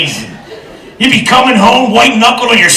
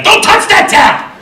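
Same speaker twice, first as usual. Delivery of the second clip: high, slow mock yell; eager husband; 13 semitones higher, slower than usual. Husband sees wife step out of shower, high excited yell. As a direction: stop her with a high excited holler.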